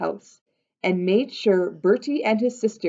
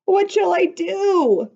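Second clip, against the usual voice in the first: high, loud, slow wail; 12 semitones higher, 4 dB louder than usual. Acted. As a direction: wail it out in despair. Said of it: "wailed Bertie".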